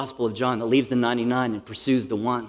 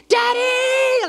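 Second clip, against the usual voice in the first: put-on very high, loud child's squeal of greeting; excited kids; 5 dB louder than usual. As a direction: shriek like a kid running to a parent. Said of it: "the kids would be excited".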